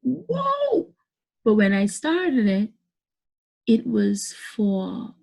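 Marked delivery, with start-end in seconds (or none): 0.0-0.9 very high astonished whoa; surprised whoa. Retold or imitated. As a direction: react in total shock. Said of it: "I was like".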